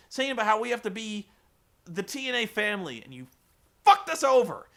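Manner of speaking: high, loud ranting scolding; furious rant. Retold or imitated. Announcer in the ring scolding Jeff Jarrett, loud furious rant. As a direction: tear into him, voice climbing. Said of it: "really pissed off".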